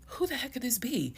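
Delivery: high-pitched retold bafflement; baffled question. Wife wondering who came to the house, baffled. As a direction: pitch jumps up high in disbelief. Retelling her bafflement at the visitor.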